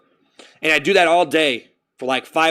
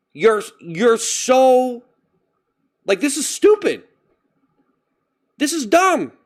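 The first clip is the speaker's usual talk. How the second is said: exasperated; 7 semitones higher, slower than usual. Retold or imitated. Frustrated with himself.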